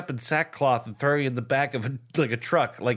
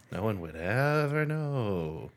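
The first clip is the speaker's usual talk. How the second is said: slow, stretched-out singsong echo; mock-dramatic echo; 4 semitones lower, 5 dB quieter, much slower than usual. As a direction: draw each word out in mock mystery. Echoes co-host, mock-dramatic.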